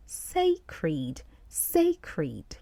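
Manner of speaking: "sacred" slowly as SAY-kreed, falling at the end; "sacred" in two spaced syllables, SAY-kreed; careful pronunciation. Shown as a demonstration. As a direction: model "sacred" as SAY-kreed, pausing between takes. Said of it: "the word we are focusing on".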